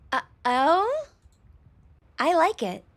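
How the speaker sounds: high, cutesy character voice; cute girl voice. Acted. Slime girl liking her first house, high and cute. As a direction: pitch it up, cute and curious. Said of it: "Wobbly, high-pitched, and very cute".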